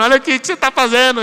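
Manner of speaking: loud and fast, exasperated scolding; hammed-up scolding shout; mock exasperated outburst. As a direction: snap back at a whining student. Student crying on video.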